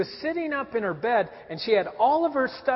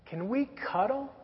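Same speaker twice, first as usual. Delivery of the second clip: put-on sleepy child; 4 semitones higher, 7 dB quieter, slower than usual. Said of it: "And she said".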